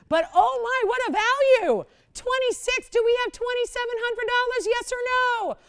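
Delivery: loud and high, begging for a bid; loud high pleading for a bid; excited pleading. Real, not acted. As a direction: push the room, almost desperate. Auction host pleading for bids.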